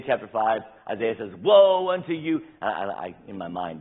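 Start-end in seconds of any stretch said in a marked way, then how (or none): 1.4-2.4 loud, high prophet's cry of woe; angry prophet calling down woe. Retold or imitated. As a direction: thunder the woe at the people. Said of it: "this is the way he says it".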